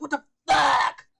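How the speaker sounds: slow, loud stunned what the; shocked outburst in the theater; stunned disbelief. Retold or imitated. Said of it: "I was like".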